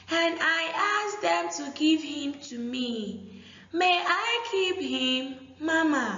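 put-on high, sing-song girl's voice; pleading girl. Shown as a demonstration. The girl begs her mother to keep the dog, high and lilting. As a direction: beg Mama sweetly, lilting high. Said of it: "repeat of passage".

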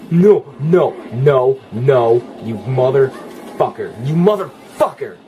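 loud, punchy panicked swearing; rattled and angry; alarmed cursing. Real, not acted. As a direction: spit out each word as you fight back. Attacked by monster in game.

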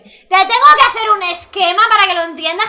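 loud exasperated scolding; exasperated rant. Real, not acted. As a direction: yell at the character in disbelief. Angry rant at character.

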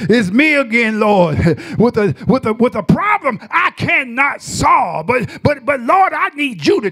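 preaching at full force. Acted. Preacher rising into fervor.

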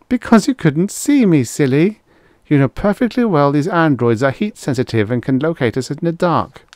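put-on high-pitched, teasing girl's voice; chiding girl. A narrator's reading. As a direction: set him straight as a know-it-all girl. Girl chides Roddy, voiced by narrator.

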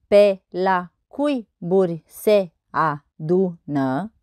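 very slow, each syllable separated. Shown as a demonstration. Teacher counting verse syllables on fingers, syllable by syllable. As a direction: count out the syllables one at a time. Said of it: "Să observăm numărând".

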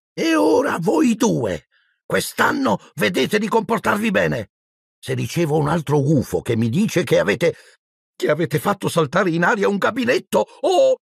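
high, warning maternal voice; stern motherly warning, climbing at the end; scolding mother. A narrator's reading. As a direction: lecture two troublemakers, pitched high. Mother sternly warns the twins.

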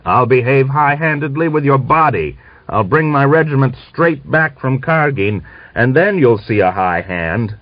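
officer threatening to bring his regiment back; angry threat. Acted. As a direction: threaten him, heated. Furious threat in quarrel.

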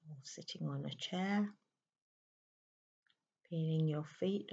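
unhurried, settling voice, leaving space to breathe; guided meditation. Real, not acted. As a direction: ease the listener into stillness, phrase by phrase.

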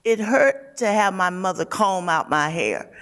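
ordinary delivery, nothing marked

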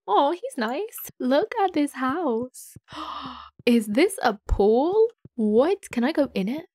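drawn-out, thrilled gushing; overexcited, slow-swooping amazement; really excited. Real, not acted. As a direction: gasp and stretch the words in delight. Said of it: "I was so excited then".

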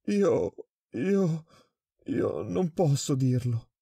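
high stuttering, slowing down; terrified mumbling. A narrator's reading. Prisoner refusing to tell the director, terrified stutter. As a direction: stumble over the words in fright. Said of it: "farfugliò il prigioniero".

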